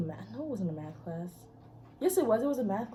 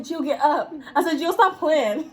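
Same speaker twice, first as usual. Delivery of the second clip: laughing through the words; 3 semitones higher than usual. Retold or imitated. Classmate laughing at the boy she kicked down. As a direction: giggle while telling him to get up.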